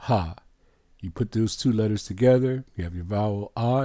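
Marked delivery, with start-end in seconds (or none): 0.1-0.4 breathy "Ha." as a single syllable; syllable sound. Shown as a demonstration. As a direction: say "Ha." as a plain letter sound. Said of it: "How is it pronounced?".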